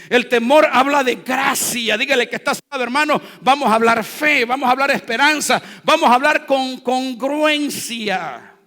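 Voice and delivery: loud, driving preacher's cry; fiery preaching. Acted. Pastor preaching to the congregation, loud fiery delivery. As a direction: build the fear in the story.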